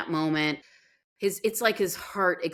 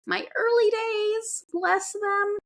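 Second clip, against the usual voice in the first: much higher, slower, fondly teasing; pitched way up in mock tenderness for her early days; high doting voice; slower than usual. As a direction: coo it like cooing over a baby. Fond look back at early days.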